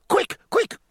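high, loud hurried call; urgent cry. A narrator's reading. French captain hurrying his servant, high urgent cry. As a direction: call out for your clothes in a rush. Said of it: "cried Captain Christipalière".

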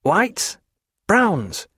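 guards offering names. A narrator's reading. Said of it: "said the others".

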